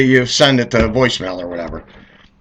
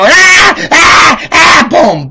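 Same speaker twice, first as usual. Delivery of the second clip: high loud yelps; mock cries of pain; 8 dB louder, much slower than usual. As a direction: yelp as if being whacked. Kid being hit, crying out.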